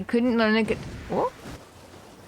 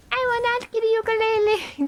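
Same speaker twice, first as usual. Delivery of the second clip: high, prim mock vow to herself; mock stern self-talk; 14 semitones higher than usual. To herself about the ukulele, high mock-stern vow. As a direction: lecture yourself in a high, fake-firm voice. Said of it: "It's also me being like".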